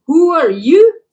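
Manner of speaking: indignant retort. Retold or imitated. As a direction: fire it back, offended. Snapping back at the counselor.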